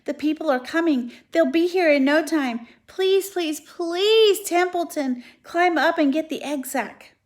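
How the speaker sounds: high, loud, rising desperation; urgent pleading. Acted.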